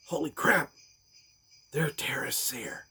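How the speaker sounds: lightly voiced alarmed hero; a hint of a startled action hero; startled John McClane. McClane's quoted exclamation in story.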